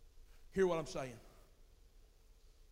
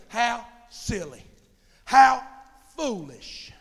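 scornful passersby; 4 semitones higher, 12 dB louder, much slower than usual. Retold or imitated. Voices passersby scorning churchgoers.

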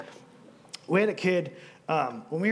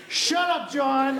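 higher, louder mock snap at the youth pastor; a teen unmuting on Zoom to tease her pastor, mock rude; teasing teen girl; 7 semitones higher, 4 dB louder, much slower than usual. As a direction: play the sassy teen telling him off, laughing. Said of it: "she would unmute from Zoom".

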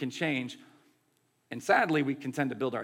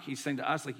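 ordinary talk, nothing marked

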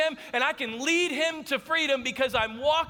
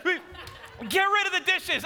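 high, mock-urgent wife voice; hammed-up panicked command; frantic orders; 7 semitones higher, slower than usual. Wife orders husband to clear the kitchen, frantic.

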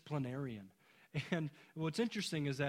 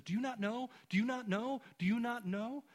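repeated question, higher and rising; urgent preaching question; 9 semitones higher, 4 dB louder than usual. Retold or imitated. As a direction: hammer the question home from the pulpit. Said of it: "he says".

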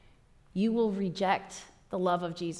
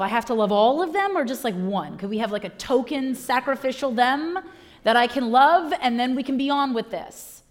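quick, louder mock haggling; mock bargaining; 4 semitones higher, 6 dB louder than usual. Believer bargaining with Jesus over whom to love, mock-whiny haggling. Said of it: "And you think to yourself".

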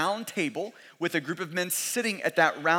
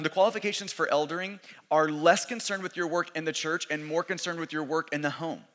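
normal talking voice

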